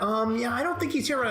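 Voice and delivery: mock evasive assistant. Mimicking assistant dodging phone calls.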